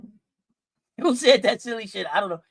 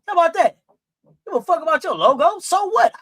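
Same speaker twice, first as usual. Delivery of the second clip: defiant; 7 semitones higher, 5 dB louder than usual. Real, not acted. Defiant about Nike logo.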